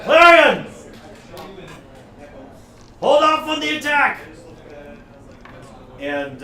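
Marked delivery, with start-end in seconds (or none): 0.0-4.2 high, booming shouted command; thaumaturgy-boosted yell. Acted. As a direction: let it thunder across the battlefield. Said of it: "the thaumaturgy voice, he yells out".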